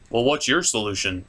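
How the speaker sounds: ordinary delivery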